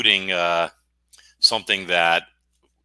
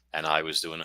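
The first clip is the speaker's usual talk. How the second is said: ordinary talk, nothing marked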